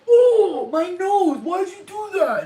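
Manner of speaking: high, very loud complaint; hammed-up hurt howl, drawn out; mock pained wail. Goofy giant hit on the nose, wailing. Giant wails after being punched.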